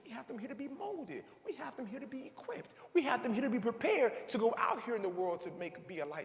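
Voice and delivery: high, ringing sermon build; preaching at full force. Acted. Preacher rousing the school gathering with a high ringing call. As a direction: preach it out to the crowd.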